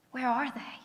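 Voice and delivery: whispered, searching "Where are they". Retold or imitated. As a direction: breathe it out like a lost mourner. Grieving question in retold scene.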